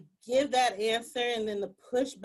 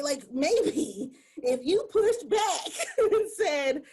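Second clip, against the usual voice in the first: laughing; 6 semitones higher, 3 dB louder, slower than usual. Real, not acted. Laughing through the setup.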